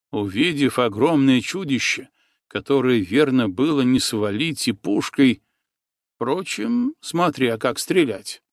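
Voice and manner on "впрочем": plain reading voice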